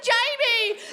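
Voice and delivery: high, loud panicked calling out; acted begging shout; desperate plea. Acts out panicked plea to Jamie.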